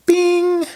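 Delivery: very high, ringing bing; intercom chime imitation.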